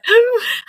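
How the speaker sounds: sharp high gasp of shock; a caught-out liar on the phone, gasping in shock. Retold or imitated. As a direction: gasp in horror. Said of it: "And I was like".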